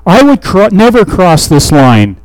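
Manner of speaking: hammed-up, insistent vow; emphatic. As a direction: play someone swearing they never would. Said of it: "making emphatic statements".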